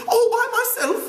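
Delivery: put-on high, indignant boy's protest; indignant cry. Piggy protesting to Ralph, high and indignant. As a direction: fling it back, offended. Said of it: "cried Piggy indignantly".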